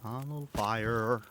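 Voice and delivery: put-on Canadian-like accent; imitating Astrid. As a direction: say it like a Brit attempting Canadian. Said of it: "Almost sounded Canadian there".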